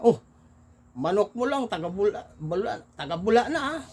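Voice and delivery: high, taunting gotcha; gloating catch. Retold or imitated. Naga men taunt the cockfighter over his rooster, gloating. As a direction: gloat at being caught out.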